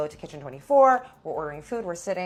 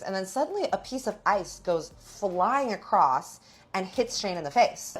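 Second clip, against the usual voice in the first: ordinary talk, nothing marked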